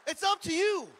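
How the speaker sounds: loud high preaching punch; emphatic shout. Real, not acted. Pastor shouts the choice back to the congregation. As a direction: drive it home to the crowd.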